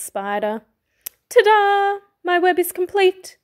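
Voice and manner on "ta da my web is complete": high, triumphant ta-da; proud announcement. A narrator's reading. Fox showing off his finished spider web, proud and high. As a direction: announce it proudly, pitched well up.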